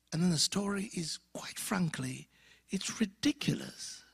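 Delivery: confiding unvoiced whisper. Real, not acted. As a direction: lean in and whisper it. Confiding the story is ridiculous.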